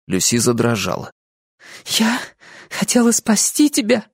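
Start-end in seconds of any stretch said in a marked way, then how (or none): 1.9-4.0 high, hesitant pleading; falter, then plead in a high voice; trembling young woman. A narrator's reading. Lucy explaining she meant to save him, pleading.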